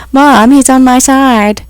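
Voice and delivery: put-on high, slow whiny child voice; imagined child complaining to mom; whiny kid. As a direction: whine like a kid in the back seat.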